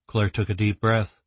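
ordinary delivery, nothing marked

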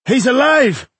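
very high, loud joyful cry; excited exclamation. A narrator's reading. Dwarf finds his companion still living, excited shout. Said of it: "exclaimed Fergus".

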